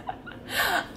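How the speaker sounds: high delighted giggle. Real, not acted. Vlogger giggling at her own excitement, high giggle. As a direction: let out a high happy giggle. Laugh after excited quote.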